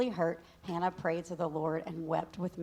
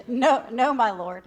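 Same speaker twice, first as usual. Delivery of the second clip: protesting; 5 semitones higher, 10 dB louder, slower than usual. Acted. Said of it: "Hannah replied".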